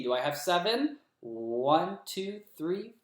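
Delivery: normal talking voice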